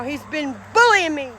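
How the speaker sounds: ordinary talk, nothing marked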